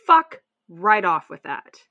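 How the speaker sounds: real loud, slow, emphatic outburst; loud indignant dismissal; outraged. Real, not acted. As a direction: land each word hard in disgusted anger. Outraged reaction to forced episiotomies.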